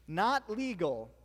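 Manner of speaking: ordinary talk, nothing marked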